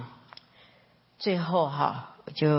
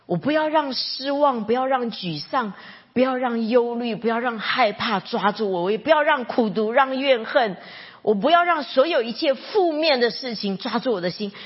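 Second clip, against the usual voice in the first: higher and louder, building preacher's fervour; impassioned appeal; 6 semitones higher, 4 dB louder than usual. Retold or imitated. Worship leader urging the congregation to surrender, loud and fervent. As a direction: preach it with rising passion.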